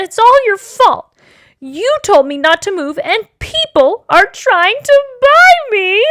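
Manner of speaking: high, loud whiny complaint; upset child wailing. Acted. Taya blaming her father from the shelf, wailing. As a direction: build to a tearful wail.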